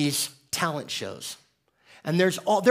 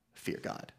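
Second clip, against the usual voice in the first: hushed awe; 13 dB quieter than usual. Acted. Quoting the verse's command.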